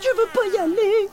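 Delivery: high, loud whiny protest; whining. Acted. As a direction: whine like a kid refusing. Whiny refusal. (refus geignard, aigu et fort)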